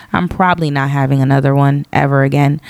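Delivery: ordinary delivery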